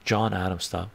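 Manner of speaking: ordinary talk, nothing marked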